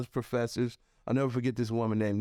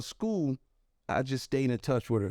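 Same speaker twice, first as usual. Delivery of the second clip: usual speaking voice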